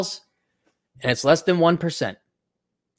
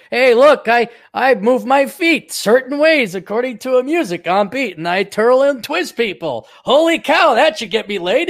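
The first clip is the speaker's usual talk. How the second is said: mock cocky dancer; 6 semitones higher, 4 dB louder than usual. Mocking his own dating logic.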